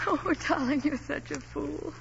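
wife tenderly teasing her husband; tender tease. Acted. Softly loving wife teasing husband.

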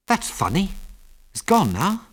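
high-pitched puzzlement; puzzled. A narrator's reading. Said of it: "said Ginger in a puzzled voice".

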